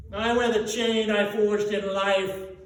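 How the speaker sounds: loud, heavy groan of a doomed spirit; groaning ghost. A narrator's reading. Marley's ghost telling of his chain, groaning. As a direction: groan under the weight of the chain. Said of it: "groaned the ghost".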